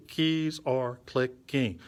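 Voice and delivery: slow "keys are clicking" in four separated beats. Shown as a demonstration. Teacher tells learner to think of beats, beat by beat. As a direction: land each syllable of "keys are clicking" on its own beat. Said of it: "Think of, about beats".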